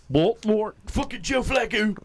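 high-pitched, hammed-up Baltimore accent. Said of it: "that's what you have with Baltimore".

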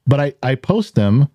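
usual speaking voice

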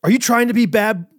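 put-on dad to toddler, playful stern question; parent scolding toddler. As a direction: ask it like a mock-stern dad to his toddler. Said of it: "but I'll say".

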